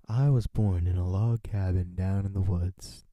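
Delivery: asked for his life story, hammed-up loud storyteller opening; mock storyteller. Starting his life story as a joke, loud mock storyteller.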